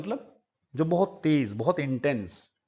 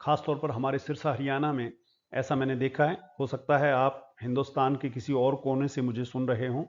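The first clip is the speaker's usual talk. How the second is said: ordinary talk, nothing marked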